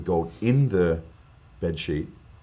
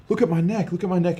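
much higher, excited look at my neck; amazed exclaiming; 10 semitones higher, 3 dB louder than usual. Retold or imitated. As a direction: imitate mum startled at her neck. Mum amazed at gold dust.

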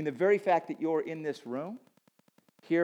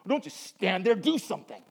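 raised, higher voice imitating dad; dad scolding; 5 semitones higher, 4 dB louder than usual. Dad to his distracted son on a work day, scolding. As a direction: play an exasperated military dad giving orders. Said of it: "opposite than the way my dad spoke to me".

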